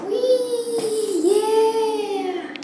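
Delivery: high happy whoop; gleeful cheer. Real, not acted. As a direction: cheer it out with glee. Happy shouts in new shoes.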